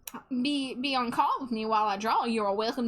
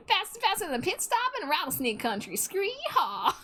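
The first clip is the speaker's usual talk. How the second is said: Southern cowboy voice; 8 semitones higher than usual. Acted. Streamer hams up a mock Southern cowboy voice for chat. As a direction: play a cartoon cowboy on the trail. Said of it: "Why am I so good at this voice".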